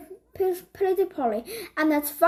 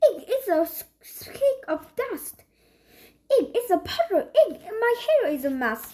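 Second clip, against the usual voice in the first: fussy cousin; 5 semitones higher than usual. A narrator's reading. Polly fussing over dirt.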